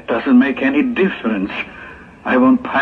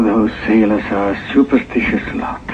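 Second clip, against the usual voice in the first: normal talking voice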